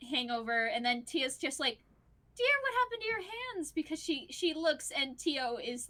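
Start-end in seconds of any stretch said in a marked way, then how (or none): 2.4-3.7 fast, high-pitched startled question; alarmed concern. Acted. Wife noticing injured hands, high quick alarm. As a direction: blurt it out in worry.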